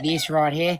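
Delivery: lightly hammed Australian accent, teasing a friend; mock Australian accent. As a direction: lay on a broad Australian accent, drawn out. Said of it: "does he sound more Australian than you do?".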